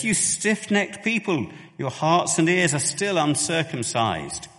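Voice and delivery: high, loud, petulant scolding; petulant rebuke. Shown as a demonstration. Preacher mocking a petulant Stephen, loud and high. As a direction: snap it at them like a petulant accuser. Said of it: "I don't think he says it in that tone of voice".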